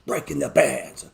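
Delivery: slow, boomed-out hype catchphrase; announcer voice. Shown as a demonstration. Hosts rehearsing a catchphrase for the edit, slow hype voice. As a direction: stretch it like a show intro. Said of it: "gotta have this ready to go".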